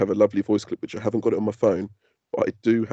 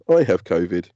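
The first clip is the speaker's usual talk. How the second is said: normal talking voice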